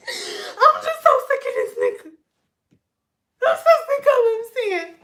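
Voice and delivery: mock sobbing client. Client breaking down over man.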